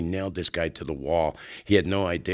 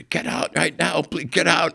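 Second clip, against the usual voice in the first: high panicked get out; scared lawyer; 11 semitones higher, 3 dB louder than usual. Retold or imitated. Frightened lawyer ordering them out, high panicked voice. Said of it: "the guy's scared to death".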